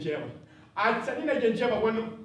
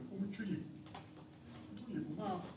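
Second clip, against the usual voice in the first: much softer, fading, near tears; sad whisper-soft; 16 dB quieter than usual. Acted. Grieving boy asks his dead mother, very soft and sad. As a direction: let it die away to mamma.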